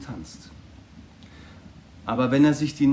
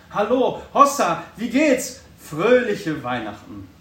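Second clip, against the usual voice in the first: higher, louder hearty hello; joyful greeting; 5 semitones higher, 9 dB louder, slower than usual. A narrator's reading. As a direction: hail him with delight. Scrooge greets the turkey man.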